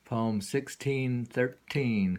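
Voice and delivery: normal talking voice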